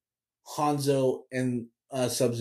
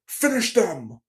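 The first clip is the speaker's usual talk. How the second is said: announcer voice; 3 semitones higher, slower than usual. Retold or imitated. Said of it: "he says".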